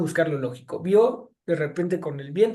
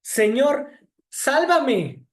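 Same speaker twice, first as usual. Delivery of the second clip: raised, higher cry for help, a mild mock shout; a desperate plea half-acted, louder and higher; 6 semitones higher, 4 dB louder, much slower than usual. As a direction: call out to the Lord as if sinking.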